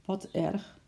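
soft, sad little murmur. A narrator's reading. Lady murmuring sadly about the lost bracelet. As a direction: murmur it sadly under your breath. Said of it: "mompelde de mevrouw verdrietig".

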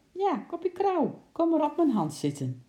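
high-pitched pet-coaxing voice; coaxing parrot. A narrator's reading. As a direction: coax the bird over in a high, singsong pitch. Lady coaxing parrot onto her hand. (met hoge stem een papegaai lokken)

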